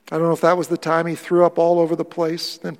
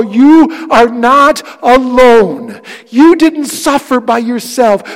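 louder and higher, slowing on the key lines; impassioned preaching; 8 semitones higher, 13 dB louder than usual. Real, not acted. Pastor assuring his grieving congregation they are not alone, fervent preaching. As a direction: pour comfort over the grieving, full voice. Preaching builds to full force.

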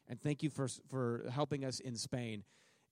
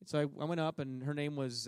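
ordinary delivery

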